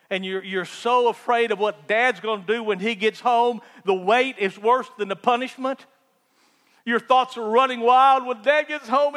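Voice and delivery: building preaching. Acted. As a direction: build it up like a pulpit climax. Preaching, builds fear scene.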